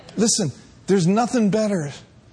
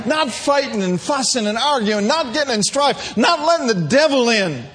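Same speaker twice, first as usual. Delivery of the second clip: rising, louder, higher preacher's shout; fiery pulpit climax; preaching at full force; 8 semitones higher, 4 dB louder than usual. Acted. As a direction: build the sermon to a shouting peak.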